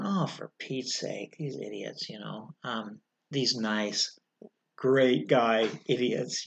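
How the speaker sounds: low, softly muttered exasperation; eye-rolling exasperation. Retold or imitated. Firefighter grumbling about the crew on the radio, eye-rolling exasperation. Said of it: "just rolling my eyes going".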